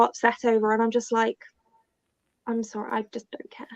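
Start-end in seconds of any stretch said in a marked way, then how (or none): 2.5-3.7 near-whispered aside; very soft, toneless confession; hushed indifference. Retold or imitated. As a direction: drop to a whisper, unmoved. Quoting her own indifferent reaction.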